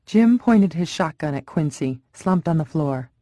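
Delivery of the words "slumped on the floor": ordinary delivery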